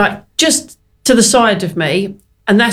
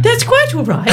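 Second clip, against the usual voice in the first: high, laughing posh voice; 14 semitones higher than usual. Retold or imitated. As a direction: imitate the Queen with a giggle. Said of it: "And she just went".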